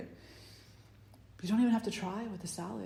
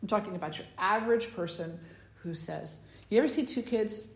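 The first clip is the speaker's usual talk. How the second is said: ordinary talk, nothing marked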